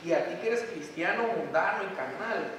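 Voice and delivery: ordinary talk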